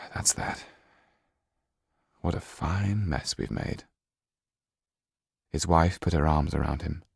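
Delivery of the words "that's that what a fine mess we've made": softer, near-whispered resignation; resigned. Acted. Henry Laxton sighs to his wife as Jan leaves, soft. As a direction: let it go with a sigh.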